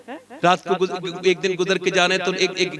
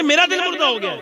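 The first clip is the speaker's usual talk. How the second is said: higher, put-on whiny complainer; mock complaining; 6 semitones higher than usual. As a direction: imitate a self-pitying follower. Mocking how people complain.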